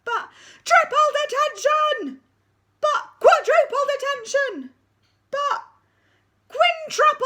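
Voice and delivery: high, loud drawn-out bellow; deputy head screaming the punishment; furious shout. Acted. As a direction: roar detention at the school.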